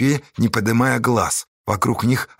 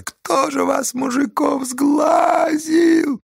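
very high, strained woman's voice; screeched outcry; much slower than usual. Acted. Woman railing at the men, high screech. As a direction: screech it far above your usual voice.

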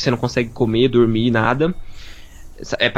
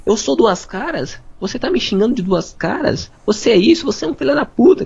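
high, indignant mock yelling; hammed-up outraged accusation; retold angry yell; 9 semitones higher than usual. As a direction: friend imitating Ruth's furious public outburst. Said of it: "começou a falar muito alto, gritando".

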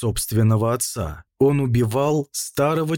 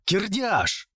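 sudden cry; 8 semitones higher, 3 dB quieter than usual. A narrator's reading. Prince summons his captain, sudden high cry. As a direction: call out abruptly, pitch jumping up. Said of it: "Выкрикнул неожиданно".

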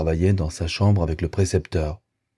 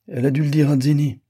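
high, quick scornful jab; a touch of girlish contempt, higher and fast; contemptuous; 8 semitones higher, 3 dB louder, faster than usual. A narrator's reading. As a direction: toss it off with disdain. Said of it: "prononça-t-elle d'un air de mépris".

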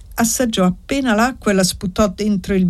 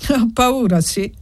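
slightly ironic; 5 semitones higher than usual. A narrator's reading. Annessa answering his taunt about fear, ironic. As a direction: echo it with a touch of irony. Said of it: "Ella rispose un po' ironica".

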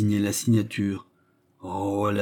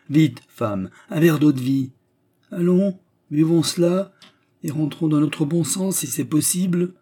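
louder orders climbing high; urgent bustling; 7 semitones higher, 4 dB louder than usual. Acted. Manager reviving the fainting suitor, urgent. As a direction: hurry them along briskly.

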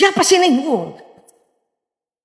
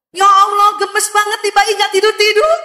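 exasperated mother; 8 semitones higher, 3 dB louder than usual. Pretend. Mother exasperated by sleepless baby.

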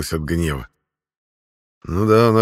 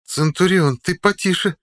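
woman's voice; 12 semitones higher than usual. A narrator's reading. Woman calls from next table.

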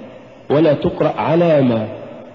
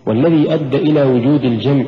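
normal talking voice

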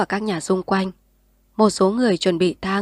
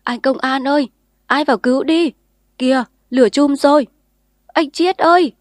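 loud, higher desperate calling; 6 semitones higher, 5 dB louder than usual. A narrator's reading. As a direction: cry out to him through the smoke. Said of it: "tiếng kêu của dân".

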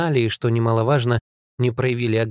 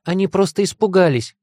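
high, raised urgent cry; calling out; 8 semitones higher, 4 dB louder than usual. A narrator's reading. Heroine defending her monsters to her father, high cry. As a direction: call out in their defence. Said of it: "крикнула".

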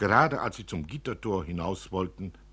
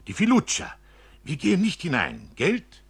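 hoch und laut, aufgeregte Kinderstimme; hastiges Kind; 10 semitones higher, 6 dB louder than usual. Acted. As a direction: schnell und aufgeregt dem Vater zuflüstern wollen. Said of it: "Felicitas sagte schnell". (aufgeregtes Mädchen, hastig)

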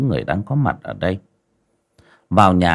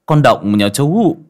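rising, louder, higher as the news comes out; urgent alarm; 6 semitones higher, 5 dB louder than usual. Acted. Neighbour telling father his daughter drowned, alarmed and rising. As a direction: blurt out the terrible news.

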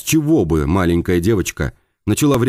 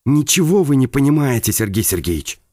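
bitter; 5 semitones higher than usual. Acted. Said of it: "с горечью ответила птица".

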